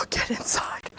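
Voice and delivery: slow whisper after a sigh; whispered coaxing. Real, not acted. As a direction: whisper the dog inside slowly. Coaxing the dog indoors.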